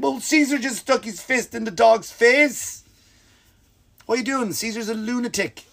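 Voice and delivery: high-pitched, hammed-up protest; mock alarm. Bitten viewer blames Caesar's fist trick, mock alarm. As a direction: pitch it up in comic disbelief.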